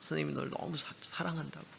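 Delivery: tender hushed confession. Retold or imitated. Teacher hugging him, saying love.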